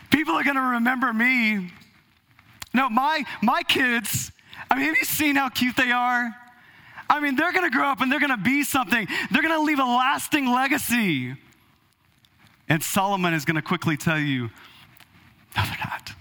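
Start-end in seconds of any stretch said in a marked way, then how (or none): 0.1-11.4 higher, eager boasting parent; proud parent. Retold or imitated. Mimicking a proud listener. 15.6-16.1 blunt whispered reply. Retold or imitated. Solomon's blunt answer, whispered.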